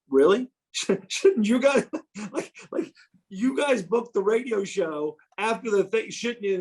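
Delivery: half-laughing, half-crying disbelief; laughing through the quote. Retold or imitated. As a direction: laugh your way through the protest. Laughing while quoting his protest.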